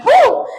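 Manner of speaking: loud, high sigh of relief; relieved whew. Retold or imitated. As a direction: blow out relief like it's over. Relief after stopping fight.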